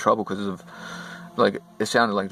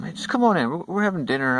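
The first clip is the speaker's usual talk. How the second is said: higher-pitched voice of a welcoming hostess; lightly voiced hostess; 10 semitones higher than usual. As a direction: imitate a hostess calling a guest in. Woman welcomes him in.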